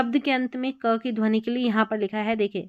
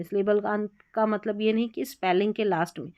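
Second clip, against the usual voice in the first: usual speaking voice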